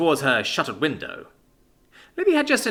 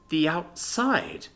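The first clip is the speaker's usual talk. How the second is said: slow, dazed question; bewildered, stretched-out question; asked slowly; 4 semitones higher, much slower than usual. Acted. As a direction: let it sink in, slowly. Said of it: "Libby asked slowly".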